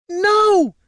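high, loud scream of a bride; screamed refusal. A narrator's reading. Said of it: "she screamed".

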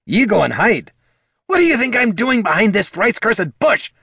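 high, loud, irritated retort; testy kobold snapping back; snapped. Acted. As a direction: fire it back in a high, indignant voice. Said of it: "snapped Bert".

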